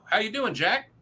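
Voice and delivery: ordinary talk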